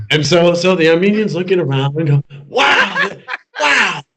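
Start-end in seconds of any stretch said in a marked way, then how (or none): 2.5-4.0 high, laughing wonder; hammed-up awe, much higher; mock amazement. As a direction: gasp at the sight, half laughing. Armenian amazed at the noses.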